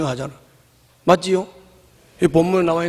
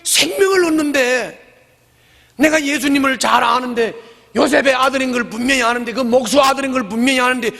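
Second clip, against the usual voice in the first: high, indignant scoffing at Jesus; grumbling crowd; 8 semitones higher than usual. Retold or imitated. Skeptical crowd grumbling about Jesus, high and scoffing. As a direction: scoff like doubters who know his father. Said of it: "이러면서 저들끼리 수군거렸다".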